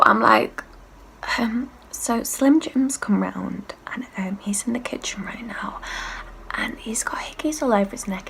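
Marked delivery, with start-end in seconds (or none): 1.9-8.3 urgent hushed whisper; softer, breathless conspiratorial whisper. Retold or imitated. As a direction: keep it secret from the kitchen, whispering. Urgently briefing flatmate in secret.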